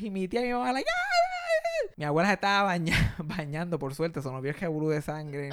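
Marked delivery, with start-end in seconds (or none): 0.9-1.9 long, very high wailing cries; mock alarmed shrieks. Aunt and mother seeing the crying children, mock shrieks. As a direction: imitate frantic relatives with drawn-out shrieks.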